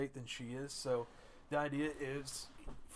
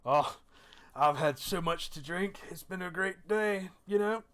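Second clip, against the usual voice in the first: put-on drunken, boastful reveller, slow and pitched up; Thorben; 6 semitones higher, 8 dB louder than usual. Dwarf bragging about the kill after much drink, tipsy boasting. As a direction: drag the words out loudly, happily drunk. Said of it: "Thorben looks at you and he goes".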